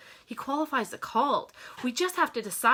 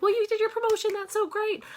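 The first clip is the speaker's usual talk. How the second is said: high-pitched mock husband voice; husband's cheery reply, voiced high; imitating husband; 11 semitones higher than usual. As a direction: chirp it back as the husband, high up. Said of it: "He's like".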